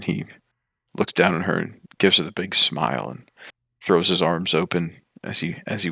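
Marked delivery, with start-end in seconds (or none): none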